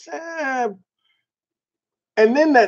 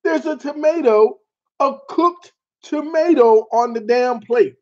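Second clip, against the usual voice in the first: normal talking voice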